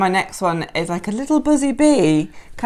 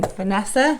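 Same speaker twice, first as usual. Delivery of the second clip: normal talking voice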